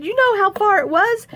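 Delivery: high, defensive retold question; worked-up protest; indignant retort. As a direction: throw it back indignantly, pitched high. Defensive answer to Ryan.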